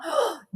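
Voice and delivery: quick high gasp; sudden sharp intake of breath. Real, not acted. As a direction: gasp mid-thought. Breaks off with a gasp.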